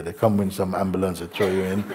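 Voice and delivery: normal talking voice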